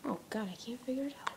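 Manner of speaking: quick, whispered frustration; frustrated whisper. Real, not acted. Can't open the case, fast frustrated whisper. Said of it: "I'm freaking out".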